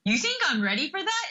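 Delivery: high, incredulous question; surprised disbelief. Retold or imitated. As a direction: retell your own stunned reply to the mentor. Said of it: "And then I was like".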